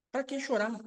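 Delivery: high, soft, whiny question; a small high plea of frustration; mock weary complaint. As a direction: play the tired believer asking why bother. Voicing the weary believer's complaint.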